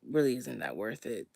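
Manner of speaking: ordinary talk, nothing marked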